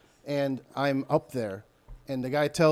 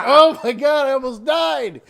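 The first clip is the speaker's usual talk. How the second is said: mock crying shout; 11 semitones higher, 9 dB louder, slower than usual.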